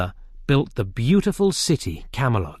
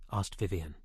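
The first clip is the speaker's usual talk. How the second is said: ordinary delivery, nothing marked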